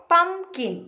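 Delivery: "pumpkin" slowly split into two distinct beats; syllable by syllable. Shown as a demonstration. Teacher showing children syllables, "pumpkin" in two separate slow parts. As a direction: break "pumpkin" after the first syllable, pum-kin. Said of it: "we are pronouncing like this".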